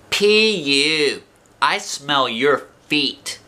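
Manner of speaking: high, slow teasing jeer; taunting boy. A narrator's reading. Jim teasing Lucille about her feet, jeering. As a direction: drawl out the insult to tease. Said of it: "he said to Lucille".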